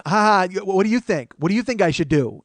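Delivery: loud, high taunting questions; retold yelling. Woman yells at friends in the cop car, taunting. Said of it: "She starts yelling at us in the car".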